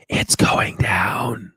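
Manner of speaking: slow breathy whisper, imitating Joe Biden; Joe Biden impression. Doing Joe Biden for his viewers, slow whisper. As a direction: hush it out slowly like a secret. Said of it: "he says the most important things in a low tone voice".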